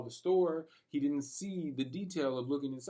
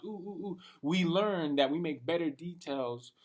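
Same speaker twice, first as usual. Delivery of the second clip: usual speaking voice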